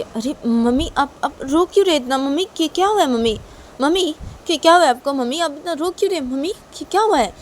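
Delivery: high, urgent, pleading questions; distressed boy begging to know what's wrong; alarmed son. Acted. Son asks why mother is crying.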